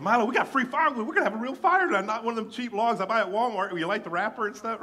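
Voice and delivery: high and loud, thrilled; bursting with excitement over free wood; gleeful excitement. Retold or imitated. Excited about free firewood.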